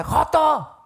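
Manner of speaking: high, loud mock yell; retold yell. Father chasing his son down the street, loud mock yell. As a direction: hurl the insult after him. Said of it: "gritándome".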